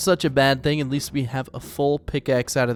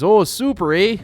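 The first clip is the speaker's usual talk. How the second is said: louder, higher imitated Canadian lilt; Canadian camp owner impression; 5 semitones higher, 5 dB louder than usual. Player quotes Canadian fishing camp owner, imitated Canadian accent. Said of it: "speak differently".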